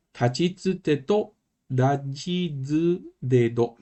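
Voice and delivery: kana read out slowly, one by one; slow recitation. Shown as a demonstration. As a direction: recite each syllable slowly and clearly. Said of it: "teacher recites kana row". (recitación lenta de sílabas)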